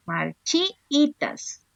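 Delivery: Marchitas drawn out, leaning on chi; slow stretched word. Shown as a demonstration. Teacher slowly finding the stressed vowel for class. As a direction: stretch Marchitas to find the accent. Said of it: "me voy a fijar dónde está el acento".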